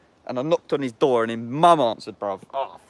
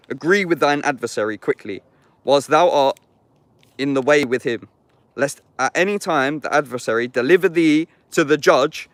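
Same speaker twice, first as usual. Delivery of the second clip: raised, solemn Bible reading; scripture reading; 3 semitones higher, 5 dB louder than usual. Acted. Reading Matthew aloud in the woods, solemn and raised. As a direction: declaim the verses like a preacher.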